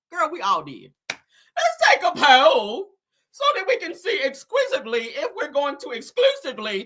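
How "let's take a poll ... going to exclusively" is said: mocking fancy talk. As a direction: drag out the big words in mockery. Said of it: "she'd like throw some big words".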